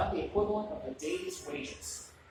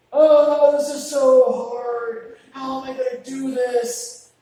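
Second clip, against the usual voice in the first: put-on loud, drawn-out whine like a kid told to clean up; imitating a disciple whining, louder and slower; whiny overwhelmed kid; 5 semitones higher, 13 dB louder, slower than usual.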